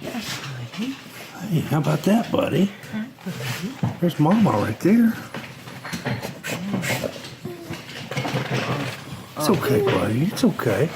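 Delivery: high, gentle voice for a hurting dog; soothing a scared dog. Real, not acted. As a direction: coo softly to calm him.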